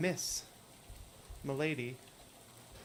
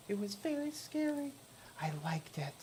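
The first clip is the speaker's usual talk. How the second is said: put-on much higher, thin ghostly girl voice; hitchhiker Lydia; 6 semitones higher, 3 dB quieter than usual. Hitchhiker asking the player for a ride, high ghostly voice. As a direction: play the strange girl in the rain begging a lift.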